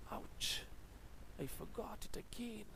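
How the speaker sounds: quiet rueful ouch; soft dismayed exclamation. Shown as a demonstration. Teacher shows an exclamation of pain to students, soft and dismayed. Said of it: "you have to have an emotion".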